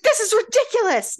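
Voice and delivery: high, loud indignant protest; shrieked indignation; outraged mother. Retold or imitated. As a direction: play the scandalised mother at full pitch.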